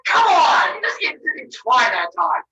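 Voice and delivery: loud fed-up complaint; exasperated scold. Acted. Wife scolding husband for no effort, loud. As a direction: call him out loudly.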